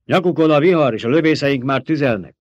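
high, loud urgent alarm; shouted warning. A narrator's reading. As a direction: cry out a warning over the storm. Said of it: "kiáltotta".

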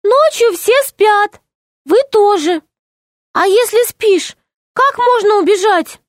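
high, earnest little gnome; tiny gnome voice. Acted. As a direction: explain it like a small nervous gnome. (тоненький голос гнома)

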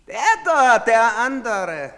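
loud, high, drawn-out shout; calling out. Acted. Official to soldiers picking out a man, calling out. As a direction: call it across, stretching the end. Points out the other suspect.

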